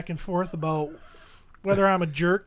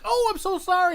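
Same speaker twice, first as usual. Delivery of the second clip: high-pitched imitated apology; retold apology; 10 semitones higher, 3 dB louder than usual. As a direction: apologise in a raised, gushing pitch.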